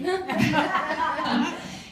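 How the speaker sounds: high, bubbling laugh of joy; delighted high laugh. Real, not acted. Speaker telling the audience her lucky story, laughing.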